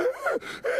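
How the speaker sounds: high, loud wordless scream; wild mock scream; crazed shriek. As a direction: shriek like a raving madman.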